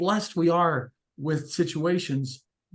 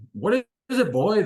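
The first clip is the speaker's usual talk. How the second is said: higher-pitched playful butcher greeting a dog; joking question; 7 semitones higher, 5 dB louder than usual. A narrator's reading. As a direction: ask it teasingly, pitched up as if to a pet. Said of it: "jokingly asked".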